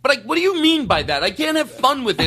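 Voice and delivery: high-pitched indignant complaint; put-on anger. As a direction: rant with mock outrage, pushing the pitch way up. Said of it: "making this sort of anger up".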